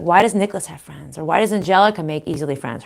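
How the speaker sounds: ordinary talk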